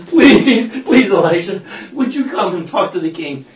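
high, laughing, hammed-up begging; laughing mock plea. Third soldier begs Elijah to come, laughing mock plea. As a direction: beg the prophet through a laugh.

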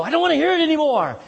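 mocking whine. Mocking a resistant listener.

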